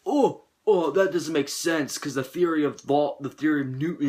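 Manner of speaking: ape a smug viewer citing physics; mocking nitpicker. Mocks moviegoers who nitpick the science, smug put-on voice. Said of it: "I hate when people are like".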